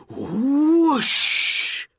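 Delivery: loud, high rising whoosh of magic smoke. A narrator's reading. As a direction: burst out a big whoosh like smoke exploding. Magic smoke bursts from lamp.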